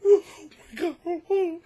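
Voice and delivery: high, loud gushing oh my God; cooing melt. Retold or imitated. Owner melting as his tiny dog looks up, high coo. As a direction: gush at the puppy eyes.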